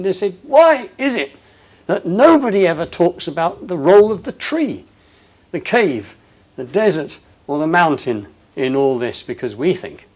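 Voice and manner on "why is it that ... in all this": higher and louder, curious and eager; animated retold question. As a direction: ask it wide-eyed, pressing the point. Retelling the host's question.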